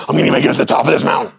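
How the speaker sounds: fast, hyped-up pep; mock hyped climber. Mocking Wim Hof style hype: fast and pumped. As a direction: pump yourself up for the summit. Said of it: "not Wim Hof breathing".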